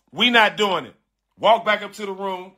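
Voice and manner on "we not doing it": ordinary talk, nothing marked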